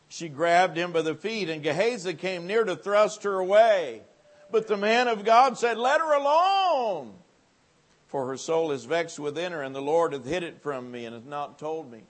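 loud, high preaching; preaching at full force. Acted. Preacher booming the prophet's words to the congregation. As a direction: boom the scripture out.

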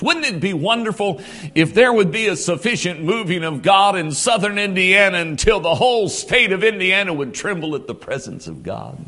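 ordinary delivery

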